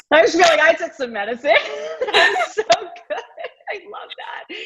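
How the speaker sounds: laughing high-pitched through the words. Real, not acted. Host delighted by her guest's words, laughing high-pitched.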